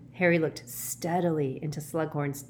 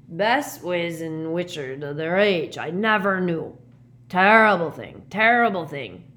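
Hagrid; 7 dB louder than usual. A narrator's reading. Mourning Harry's parents with a friend, loud lament. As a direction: lament it with heavy punches of volume.

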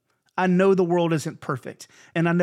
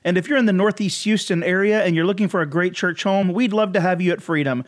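louder announcer-style sign-off; podcast outro read; 3 semitones higher, 5 dB louder than usual. Acted. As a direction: wrap up the show like an ad read, louder.

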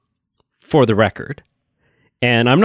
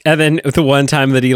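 usual speaking voice

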